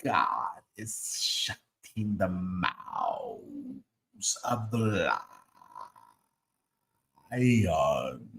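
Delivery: slow, hushed preacher voice, a low cry on lion; Holy Ghost voice. Retold or imitated. Host mocking the preacher's fake voice, slow and hushed. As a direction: intone it like a mock sermon. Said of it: "I'm speaking with my Holy Ghost voice".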